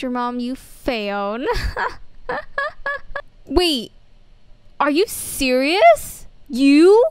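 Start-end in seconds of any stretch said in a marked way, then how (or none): 1.5-3.3 high giggling cackle; mischievous snitch laughing; sly high laugh. Acted. Friend admits telling mom. 3.5-7.0 loud, disbelieving, much louder on you; betrayed girl shouting at her friend; shocked outrage. Acted.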